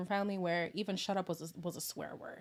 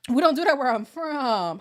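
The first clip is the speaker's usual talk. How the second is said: higher, much louder declaration; loud emphatic repeat; 5 semitones higher, 12 dB louder than usual. Shown as a demonstration. Repeating her family rule to viewers, a loud declaration. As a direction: belt it out proudly.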